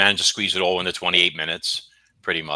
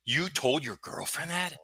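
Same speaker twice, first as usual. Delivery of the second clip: much higher, softer mock-scandalized voice; outraged womanhood; 7 semitones higher, 7 dB quieter than usual. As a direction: clutch your pearls, hammed-up shock. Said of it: "I'm a, a outraged womanhood now".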